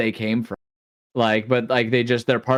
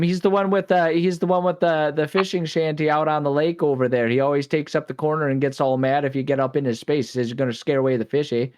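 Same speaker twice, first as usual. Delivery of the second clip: put-on Minnesota accent. Midwestern neighbor bit in accent.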